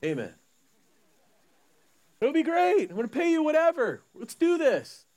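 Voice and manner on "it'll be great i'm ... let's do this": hyped-up owner. Acted. Owner hyping workers.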